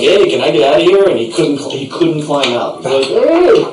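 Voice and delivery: ordinary talk, nothing marked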